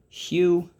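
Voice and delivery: hu said alone, high and stretched as the first syllable; isolated syllable hu, pitched high. Shown as a demonstration. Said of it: "First syllable".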